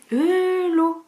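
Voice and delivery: high loud exclaimed Eula, said lo; Norman eula. Shown as a demonstration. Showing viewers her Norman word, exclaimed Eula. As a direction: cry out Eula like a Norman surprise. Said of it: "ça veut dire oh la vache en normand".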